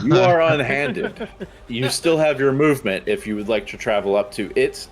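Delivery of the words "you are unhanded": laughing. Acted. DM replies in mock grandeur.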